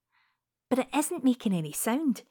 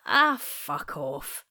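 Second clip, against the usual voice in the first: amused mutter; 8 semitones lower, much slower than usual. Acted. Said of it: "I smile to myself and I say".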